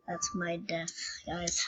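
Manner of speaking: breathy mock-tragic whisper. To viewers about the in-game funeral, mock-dying whisper. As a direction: whisper it like last words.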